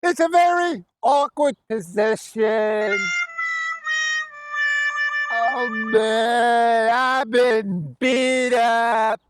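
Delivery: high, hammed-up character voice; Mister Guest. Special guest needs to beat this person up, hammed-up high shouting. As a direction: call it out high with stretched phrase endings. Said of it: "I'm that special guest".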